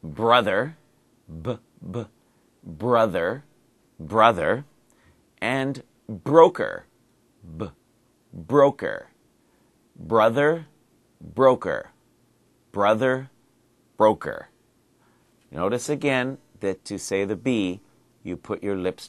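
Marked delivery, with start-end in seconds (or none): 0.0-4.6 Brother with a firm popped B at the start. Shown as a demonstration. Teacher drilling a focus word for learners. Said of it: "two words I wanna focus on". 5.4-14.5 broker with a clear popped B, drawn out. Shown as a demonstration. Teacher drilling brother and broker for learners. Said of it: "two words I wanna focus on".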